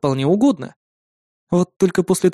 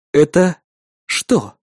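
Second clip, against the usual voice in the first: forced calm; 3 dB louder, much slower than usual. A narrator's reading. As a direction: hold the anger in, word by word. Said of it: "Стараясь говорить спокойно".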